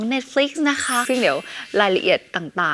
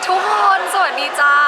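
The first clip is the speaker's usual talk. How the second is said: bright greeting; 9 semitones higher, 4 dB louder than usual. Real, not acted. Greeting viewers.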